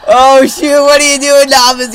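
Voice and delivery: high, loud complaint at the keeper; dismayed wail. Real, not acted. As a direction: wail at your useless goalkeeper. Wailing at own goalkeeper after goal.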